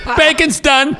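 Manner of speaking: high, loud gleeful announcement; joking call-out. Retold or imitated. Husband joking to wife as smoke alarm goes off, calling out. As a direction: sing out the joke across the house.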